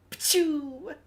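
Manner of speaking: high loud mock launch sound; high sneeze-like pachoo. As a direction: blast out a cartoon pachoo.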